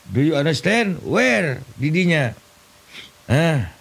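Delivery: normal talking voice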